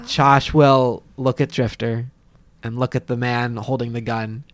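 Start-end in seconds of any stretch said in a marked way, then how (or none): none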